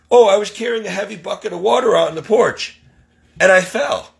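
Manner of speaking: quick, high-pitched imitation of her; mock excuse-maker. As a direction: imitate someone pleading an excuse. Mimics Donna explaining her fall.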